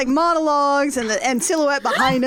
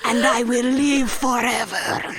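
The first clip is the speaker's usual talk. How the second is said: high, slow hammed-up anguished vampire; over-the-top tragic count; mock Dracula; 7 semitones higher, slower than usual. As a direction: wail it like a melodramatic Dracula. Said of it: "he's like".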